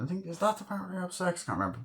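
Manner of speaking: ordinary delivery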